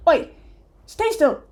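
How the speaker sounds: loud, high barked order; sudden commanding yell; sharp command. Acted. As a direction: bark it to freeze someone on the spot. Dwarf orders player to freeze.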